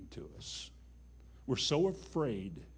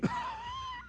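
high laugh; 7 dB quieter than usual. Real, not acted. Preacher laughs at own skit.

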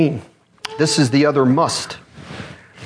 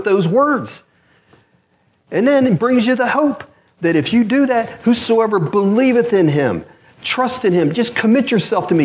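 raised-pitch, urgent sermon delivery; impassioned preaching; 6 semitones higher than usual. Real, not acted. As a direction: preach it with rising fervour, leaning on key words. Preaches conviction and hope to congregation.

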